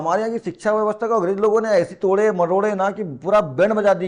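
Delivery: usual speaking voice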